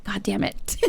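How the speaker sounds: a soft, deflated mutter under the breath; muttered curse. Retold or imitated. To chat, quietly cursing her auto message. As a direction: let it drop out quietly in disappointment.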